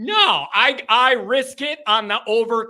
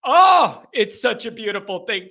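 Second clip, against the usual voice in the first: ordinary delivery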